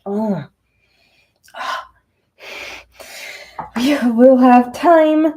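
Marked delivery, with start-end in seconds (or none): 3.8-5.3 high, loud and slow, drawn-out; sing-song it to calm yourself down; goofy reassurance. Pretend. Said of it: "I like to act goofy like that to calm myself down".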